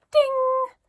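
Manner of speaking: high, loud ding of a bell; imitated shop bell. A narrator's reading. Narrator voicing the shop door bell, bright ding. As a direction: ring out like a shop doorbell.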